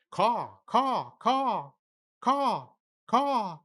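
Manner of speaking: high, loud cawing like a crow. Acted. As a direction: shriek out crow calls. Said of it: "And I'm a crow".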